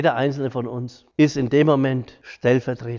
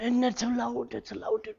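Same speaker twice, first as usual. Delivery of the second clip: hushed, higher and much softer; imitating hushing; 7 semitones higher, 9 dB quieter than usual. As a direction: mimic anxious relatives shushing at the bedside. Hushing relatives at the bedside.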